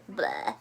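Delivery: a short disgusted blech at eating bugs; disgusted bleh. Acted. Reader grossed out by the bug meal, disgusted bleh.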